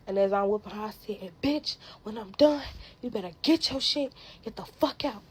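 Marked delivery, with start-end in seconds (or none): 1.4-5.2 loud, higher reenacted yell; retold angry threat. Threatening the woman while beating her, a loud yell. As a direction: bark the threat mid-fight.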